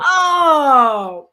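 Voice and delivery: a loud, high shout of shock; sudden loud alarmed oh; startled cry. Real, not acted. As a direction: cry out at the news. Reacts to performance check.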